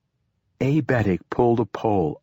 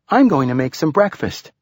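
put-on higher-pitched girl's voice in narration; higher, lively girl; girl character; 6 semitones higher, 4 dB louder than usual. A narrator's reading. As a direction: pitch it up as the girl announcing her plan.

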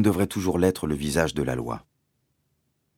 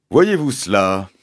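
ponderous sergeant, louder than narration; police sergeant; 3 semitones higher, 7 dB louder than usual. Acted. Sergeant addressing nobody in particular, ponderous and loud. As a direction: draw it out like a village policeman.

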